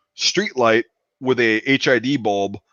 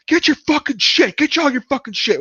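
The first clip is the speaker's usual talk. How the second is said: high-pitched mock scream of an angry dad; mimicked screaming; 13 semitones higher than usual. Friend's dad ordering kid to get his stuff, mimicked screaming. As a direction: scream it like a furious dad, imitated.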